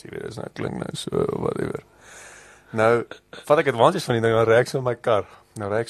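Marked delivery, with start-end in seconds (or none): 0.0-1.8 low, unvoiced rasp with no pitch; hoarse voice. Real, not acted. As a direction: scrape it out like sick lungs. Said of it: "my stem so bronchitis".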